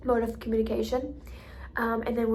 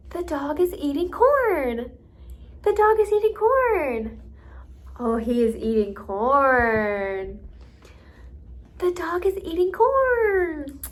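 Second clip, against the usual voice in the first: slow singsong, CORN stretched high and loud; teacher modeling to a child, CORN drawn out and emphasized; 6 semitones higher, 6 dB louder, slower than usual. Shown as a demonstration. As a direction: lean on CORN, drawing it out with a big pitch swoop. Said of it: "it might look like this".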